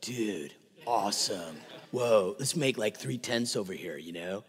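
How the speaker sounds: low, laid-back California surfer drawl; mellow, trailing California slacker talk; California dude. Acted. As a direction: play an awed California dude, voice dropped low. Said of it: "if he was from California".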